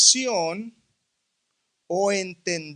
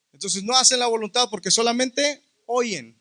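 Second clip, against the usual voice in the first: normal talking voice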